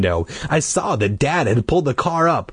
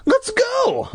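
very high, eager push; urging. A narrator's reading. Child urging parents to go, eager. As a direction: urge them on with a high-pitched cry. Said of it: "I urged".

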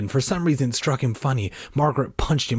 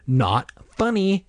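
slow, high-pitched insistence; unamused, drawn out and pitched up; repeated objection; 9 semitones higher, slower than usual. A narrator's reading. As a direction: repeat it higher, more exasperated. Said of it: "she repeated".

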